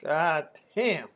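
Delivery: normal talking voice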